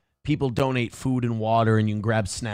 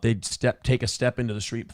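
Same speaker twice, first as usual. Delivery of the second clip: ordinary talk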